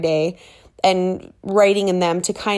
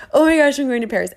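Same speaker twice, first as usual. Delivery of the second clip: higher, louder, fast giddy chant; fast and louder, bubbling over; even more excited; 6 semitones higher, 5 dB louder, faster than usual. Retold or imitated. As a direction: squeal it as the excitement peaks. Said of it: "just bouncing".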